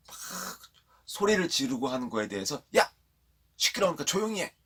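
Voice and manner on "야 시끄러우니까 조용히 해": high-pitched mock telling-off; sudden scolding outburst, acted lightly; retold scolding. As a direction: imitate a crowd shushing a noisy beggar. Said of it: "이렇게 막 꾸짖은 거예요".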